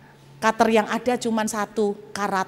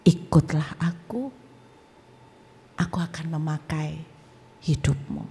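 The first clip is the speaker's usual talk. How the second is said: Tuhan Yesus; 6 semitones lower, 3 dB quieter than usual. Retold or imitated. Calling a new follower to service, low and solemn. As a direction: speak softly and deliberately, sinking lower. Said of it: "Lalu dia berbicara".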